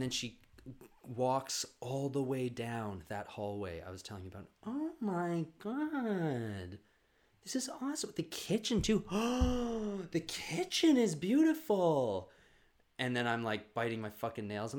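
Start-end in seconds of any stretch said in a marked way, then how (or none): 4.7-12.3 high, breathy-free gasping awe over the kitchen; gushing neighbor. Retold or imitated. Nosy neighbor to the family, gushing high over the kitchen. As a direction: gush over every room like a nosy guest.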